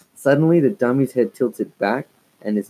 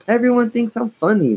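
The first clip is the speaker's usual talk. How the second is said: insisting; 13 semitones higher, 4 dB louder than usual. A narrator's reading. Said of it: "Lindsay insisted".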